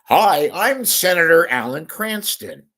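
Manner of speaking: put-on senator impression; Alan Cranston. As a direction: announce yourself high and loud like a politician. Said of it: "look at this".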